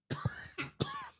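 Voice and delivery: sudden real coughing fit; coughing after water went down the wrong way. Real, not acted. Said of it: "It's the water".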